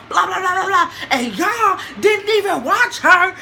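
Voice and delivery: normal talking voice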